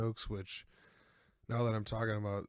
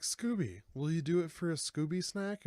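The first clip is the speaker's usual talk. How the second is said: gang coaxing Scooby; 7 semitones higher than usual. Retold or imitated. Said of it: "they're like".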